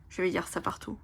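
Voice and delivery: ordinary talk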